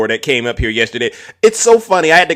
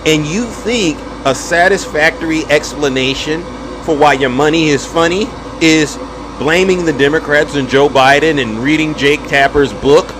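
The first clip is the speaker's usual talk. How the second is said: ordinary talk, nothing marked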